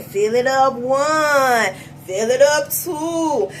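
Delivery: put-on slow singsong kids' show voice; lightly voiced Barney counting; Barney sing-song. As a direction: chant it like a children's host. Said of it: "Just like Barney told you".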